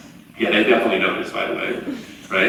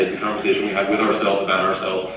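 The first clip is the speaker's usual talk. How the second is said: ordinary talk, nothing marked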